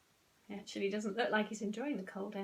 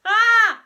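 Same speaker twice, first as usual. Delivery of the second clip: high, very loud yell; child's scream; loud screech; 13 semitones higher, 20 dB louder than usual. A narrator's reading. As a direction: screech back in outrage. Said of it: "screeched Henry".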